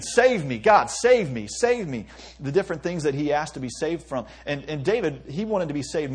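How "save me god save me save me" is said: loud urgent plea, repeated; raised, pleading cry. Retold or imitated. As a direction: call out for rescue. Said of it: "they're saying".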